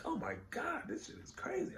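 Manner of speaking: very high, breathy imitation of his wife; high mock wife voice. Wife reacting to the comic panels, high amazed squeal. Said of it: "and she's like".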